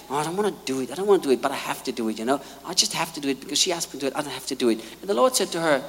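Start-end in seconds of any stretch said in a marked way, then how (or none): 0.1-4.8 low, soft, fast grumbling; grudging attitude. Retold or imitated. Candy heads into the kids' meeting, grudging grumble. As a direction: mutter it like a sulky servant. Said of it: "walked in with this attitude".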